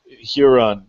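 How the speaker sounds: normal talking voice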